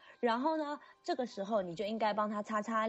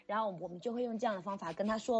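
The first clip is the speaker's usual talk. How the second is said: normal talking voice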